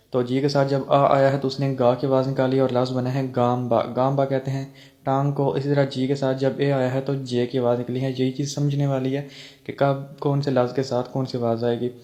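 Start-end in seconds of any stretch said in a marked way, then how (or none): none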